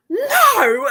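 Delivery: high, loud retold "No!"; mock aggressive cry of "No!". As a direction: shout the "No!" as a friend did. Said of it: "Like, so aggressively".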